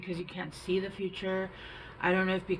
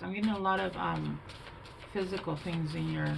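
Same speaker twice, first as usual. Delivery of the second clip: normal talking voice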